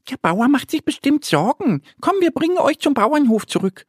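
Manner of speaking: high, quick little duck voice; bright high duckling, hurried and caring; little duck Annie. Acted. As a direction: chirp it out like a small eager duck.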